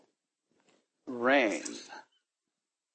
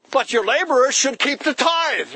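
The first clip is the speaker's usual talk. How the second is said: mock objection; 9 semitones higher, 4 dB louder than usual. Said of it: "mocking objection".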